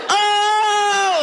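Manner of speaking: put-on high shouted "Oh, shit" like a startled child; kids' shocked yelp. Kids in the back seat spotting a naked man, high startled shout. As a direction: squeal it the way a startled kid would. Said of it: "They were like".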